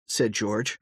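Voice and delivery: ordinary delivery, nothing marked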